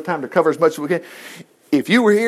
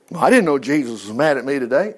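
mock surprised sinner; 5 semitones lower than usual.

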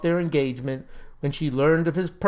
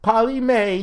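high, louder lovelorn wail; weeping wail; 7 semitones higher, 5 dB louder than usual. A narrator's reading. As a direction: wail for the lost sweetheart. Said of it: "he wept".

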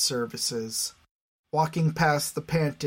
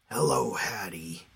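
low calm man voice; 8 semitones lower, 3 dB quieter than usual. Acted. Scarab greets Hattie.

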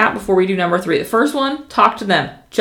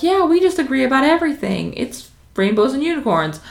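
higher-pitched mock agreement; mock agreeable; 6 semitones higher than usual. Mimicking people who agree on everything, mock agreeable. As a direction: put on a sing-song everyone-agrees voice.

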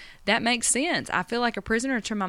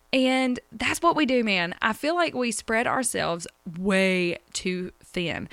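ordinary delivery

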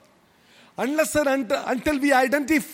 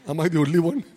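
usual speaking voice

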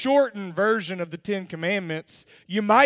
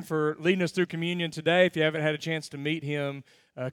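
ordinary delivery

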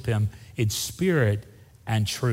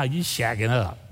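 casual paraphrase; 3 semitones lower than usual. Acted. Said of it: "that's the Weatherford translation".

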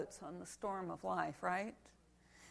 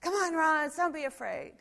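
higher, louder encouraging shout; youth group cheering; 7 semitones higher, 7 dB louder than usual. Retold or imitated. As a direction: call her on like kids rooting for a friend. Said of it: "But they said".

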